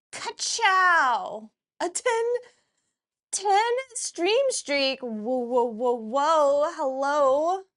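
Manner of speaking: loud and high, hyped up; excited shouting. Real, not acted. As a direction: burst in with big energy. Hyped greeting, catchphrase shouts.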